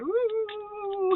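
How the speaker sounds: one long, high "woo"; excited whoop. Real, not acted. Reader cheering lovers to get together, excited whoop. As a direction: let out a swooping high whoop.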